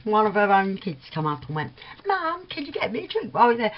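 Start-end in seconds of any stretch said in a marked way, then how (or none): 2.1-3.7 put-on child voice. Child asking mum for drink.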